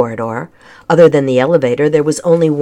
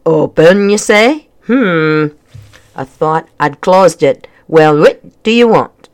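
gruff, louder Scottish-style brogue; grumbling kilted man with a thick brogue; thick accent; 5 dB louder than usual. A narrator's reading. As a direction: puzzle it over in a thick Scottish burr. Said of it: "his accent was thick and tricky to understand".

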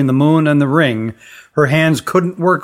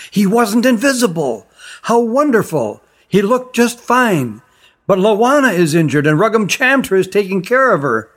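put-on higher, eager girl's voice; a girl bursting with wonder; girl telling the magician she saw her friend; young girl Tally; 6 semitones higher than usual. A narrator's reading. Tally's excited news.

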